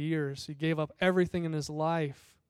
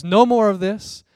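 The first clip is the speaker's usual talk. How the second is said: much louder command to stop; commanding shout; 3 semitones higher, 10 dB louder than usual. Acted. Jesus stops the fight, loud commanding call. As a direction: halt the violence with one loud order. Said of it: "That's when Jesus says".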